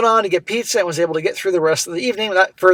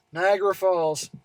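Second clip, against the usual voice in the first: ordinary talk, nothing marked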